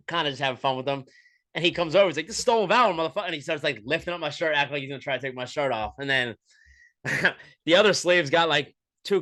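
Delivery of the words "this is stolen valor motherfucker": high, loud mock outrage; mock comedian accusation. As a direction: accuse him like a comic hamming it up. Comedian accusing over shirt.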